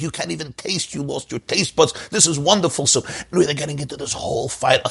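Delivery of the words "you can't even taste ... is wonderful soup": high-pitched bickering wife; acted marital squabble; mock quarrelling. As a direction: protest indignantly, pitched high. Wife argues back in staged quarrel.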